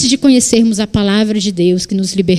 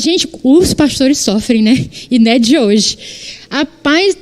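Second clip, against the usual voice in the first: usual speaking voice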